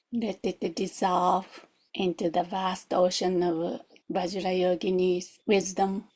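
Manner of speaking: ordinary talk, nothing marked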